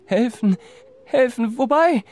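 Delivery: high, loud frightened stammer; panicked stutter. Acted. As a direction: stumble over the words in fright. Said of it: "stammelte Wilhelm".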